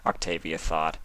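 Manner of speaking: ordinary delivery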